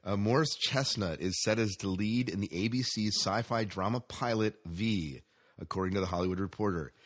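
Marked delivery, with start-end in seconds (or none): none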